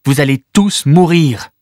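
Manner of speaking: put-on girl warning the crowd, slow and firm; determined warning. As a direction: hammer home the danger slowly. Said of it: "d'une voix décidée".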